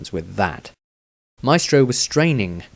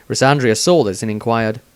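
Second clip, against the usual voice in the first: ordinary delivery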